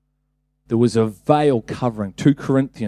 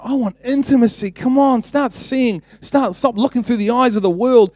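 high-pitched, impassioned pleading; urgent appeal; 9 semitones higher than usual. Acted. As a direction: urge them on as if voicing Jesus. Acting out Jesus urging believers.

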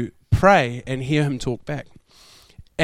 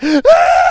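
sharp high cry as salt hits the burn; yelp of pain; 10 semitones higher, 7 dB louder than usual. Retold or imitated. Sunburnt surfer hits salt water, sharp high yelp. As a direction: yelp at the sting.

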